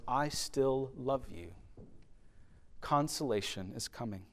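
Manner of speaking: whisper-close comfort to the hurting; tender reassurance. Acted. God's voice quoted in sermon.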